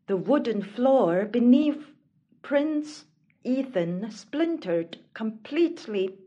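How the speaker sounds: usual speaking voice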